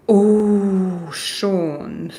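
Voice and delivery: ordinary talk, nothing marked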